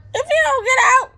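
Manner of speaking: high, loud put-on threat; half-joking warning to get out; mock threat. Telling off a rude passenger, loud mock threat.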